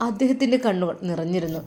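usual speaking voice

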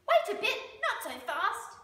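high, loud echidna voice; urgent interruption. A narrator's reading. Echidna pops up to stop Dingo, high loud call. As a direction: call out high to halt someone.

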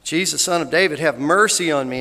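loud retold plea, near normal pitch; repeated calling out. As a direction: keep calling out over the crowd. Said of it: "And he kept saying it".

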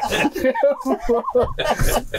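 a real burst of high laughter. Real, not acted. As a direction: laugh high and breathless at the joke. Laughs at his own story.

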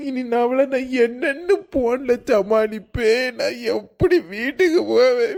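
comic sob through the question; sobbing where will I go home; crying. Acted. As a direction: wail it like a lost husband. Husband repeats line weeping.

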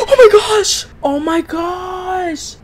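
startled, high-pitched panic; jump-scare fright. Real, not acted. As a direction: squeal it in shock, then trail into a slow wail.

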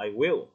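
loud stress on WILL; full "I will", WILL hit hard; emphatic WILL. Shown as a demonstration. Teacher showing what not to emphasize, WILL stressed. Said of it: "không cần phải nhấn mạnh vào".

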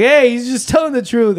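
high, loud defensive shout; mom jumping in. Acted. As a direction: butt in loudly to back someone up. Mom voiced in quarrel.

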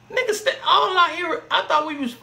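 high, loud laughing disbelief. Real, not acted. Laughing at the angry voices in the background. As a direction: laugh through the words in disbelief.

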